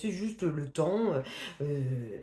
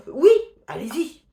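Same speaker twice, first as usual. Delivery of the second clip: sudden loud, high burst of encouragement; exclaimed, eager go-ahead; emphatic urging; 7 semitones higher, 12 dB louder than usual. Real, not acted. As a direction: shout the yes, then push them to go. Answers imagined hesitant readers, urging them.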